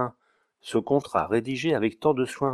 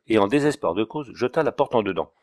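plain reading voice

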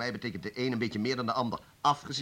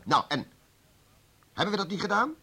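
much higher and louder, defensive outburst; heated retort; 9 semitones higher, 7 dB louder than usual. Acted. Chief flares up defending what they did, high and loud. Heated argument between officials.

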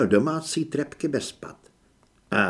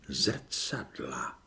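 eerie breathy whisper; a ghostly whispered Zrcadla; 12 semitones lower, 9 dB quieter than usual. A narrator's reading. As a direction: breathe the word like a secret.

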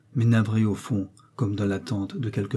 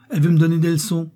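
higher, louder and fast furious rant; furious outburst; 6 semitones higher, 6 dB louder, faster than usual. A narrator's reading. Mother-in-law losing all measure with her son's wife, higher, louder, fast. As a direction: lose all control. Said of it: "perdant toute mesure".

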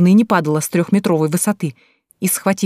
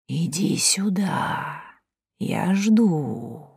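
slow, soft eerie whisper; singsong whisper; 6 dB quieter, much slower than usual. Acted. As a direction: beckon her in a creepy hush. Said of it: "Жуткий шёпот".

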